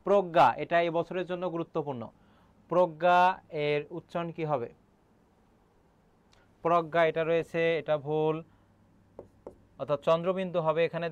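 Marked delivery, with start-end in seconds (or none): none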